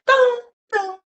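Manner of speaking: high, loud mock banging on the door; imitated banging. Imitating the Ring camera clip of banging, high and loud. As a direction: boom it out like fists on a door. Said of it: "It said".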